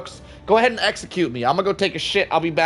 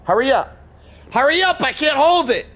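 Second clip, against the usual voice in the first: urgent plea; 8 semitones higher, 3 dB louder than usual. Real, not acted. Urgent bathroom plea to teammate.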